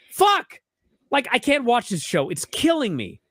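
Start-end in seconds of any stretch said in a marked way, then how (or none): 0.1-0.6 sharp, much higher and louder frustrated curse; exasperated curse. Real, not acted. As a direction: spit it out, fed up with the show. Exasperated outburst mid-rant.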